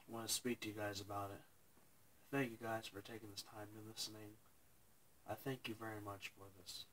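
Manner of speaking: quiet, choked-up words through real tears; holding back tears while thanking viewers; real tearful speech. Real, not acted. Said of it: "I'm terribly sorry if I'm crying".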